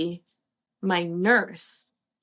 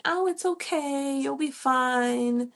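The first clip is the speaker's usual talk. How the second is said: imitating soothing medical staff, higher and slow; mock soothing staff; 7 semitones higher than usual. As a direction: lightly mimic staff comforting a crying patient, slow and high. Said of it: "the medical persons were telling me".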